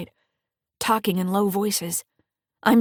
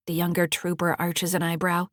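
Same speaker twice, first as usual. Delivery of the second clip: plain reading voice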